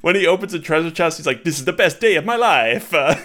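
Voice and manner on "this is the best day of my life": mock Italian accent, pitched up and louder; bad Italian accent. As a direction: ham up the Rabbid's cheesy Italian. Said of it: "terrible Italian accent voice".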